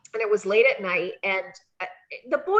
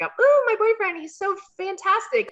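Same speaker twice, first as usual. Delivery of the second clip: put-on high, loud gushing girlfriend; swooning girlfriend; 11 semitones higher, 4 dB louder than usual. At ringside to Deuce, high gushing girlfriend. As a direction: gush over your man. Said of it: "give him a kiss".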